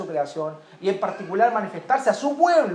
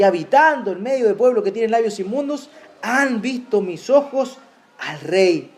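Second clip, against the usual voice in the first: loud, fervent scripture cry; fervent preaching; 5 dB louder than usual. Acted. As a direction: proclaim it with full force. Preacher recites Isaiah's cry. (proclamación ferviente)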